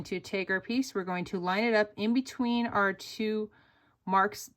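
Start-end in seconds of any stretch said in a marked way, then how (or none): none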